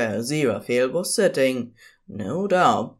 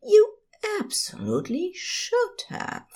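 much higher, teasing partner voice; knowing tone. A narrator's reading. Aziraphale hinting he wants the gift, high teasing voice. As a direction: let the real meaning show in the tone. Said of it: "in that tone of voice that said".

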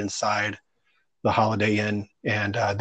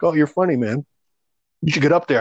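mock encouraging guys; 5 semitones higher, 5 dB louder than usual. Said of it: "they were like".

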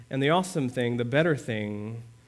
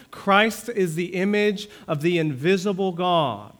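raised, higher, louder scripture proclamation; fervent preaching; 7 semitones higher, 6 dB louder than usual. Acted. Pastor proclaiming scripture to the congregation, raised and fervent. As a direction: proclaim it to the whole church.

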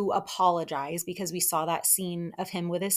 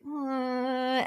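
high, mock pitying hum; 6 semitones higher than usual. Woman looking at the man she pities, pitying. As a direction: imitate a sympathetic wince in sound. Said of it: "she just pities him".

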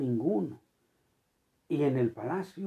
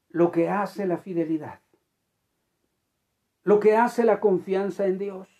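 usual speaking voice

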